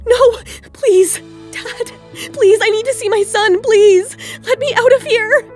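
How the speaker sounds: high, loud panicked plea; desperate begging. Acted. Locked-in mother begs her dad to see her son, desperate. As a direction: plead frantically to be let out.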